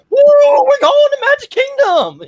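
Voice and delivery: high, loud gushing squeal; mock excited cheer. Teasing a fast-walking friend with a giddy high squeal. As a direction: ham up a giddy theme-park fan.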